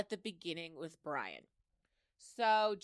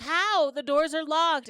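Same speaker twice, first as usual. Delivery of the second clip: mock alarm; 7 semitones higher, 8 dB louder than usual. Lori panicking at locked library.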